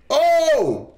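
sudden high, loud "Oh!"; surprised shout. Real, not acted. Streamer startled mid-match, high loud exclamation. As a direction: yelp in surprise.

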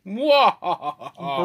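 high, loud evil cackle; mad scientist cackle. Acted. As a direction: cackle like a villain hatching a plan. Cackle again after the title.

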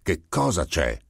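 mildly stern question; irritated, terse challenge; hard demand. A narrator's reading. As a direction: ask with a touch of hardness. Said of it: "chiese duramente".

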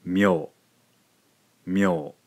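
"myo" as one clear syllable; Japanese myo. Shown as a demonstration. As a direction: model "myo" carefully for learners. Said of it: "we end up with".

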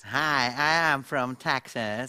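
slow, twangy Texan accent; Texas twang. Shown as a demonstration. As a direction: drawl it like a Texan. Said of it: "Dat is twang".

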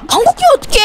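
high-pitched outraged complaint; exasperated high scold. Real, not acted. Scolding blue for farting. (기막혀서 높게 꾸짖기)

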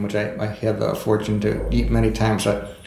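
normal talking voice

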